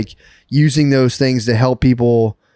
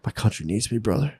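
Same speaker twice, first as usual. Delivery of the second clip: mock solemn; 3 semitones lower, 11 dB quieter than usual. Imitating the psychic's proud reply.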